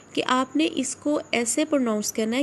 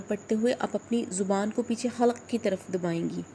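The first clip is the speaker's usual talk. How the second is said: usual speaking voice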